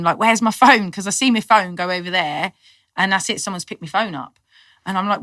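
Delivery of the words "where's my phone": retold scream. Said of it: "and I'm screaming".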